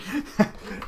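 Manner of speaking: high, voiceless burst of laughter; breathy laugh. Real, not acted. Reviewer cracking up over the magazine, breathy laugh. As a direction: break off into a quick airy laugh.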